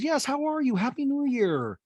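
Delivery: high, drawn-out greeting; Garcelle impression. Garcelle wishing happy new year, high slow gushing voice. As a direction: gush it high, stretching the end. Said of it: "Garcelle goes".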